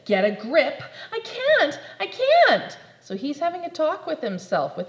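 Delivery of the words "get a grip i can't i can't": high loud wailing I can't; despairing. Acted. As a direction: give up. Inner despair.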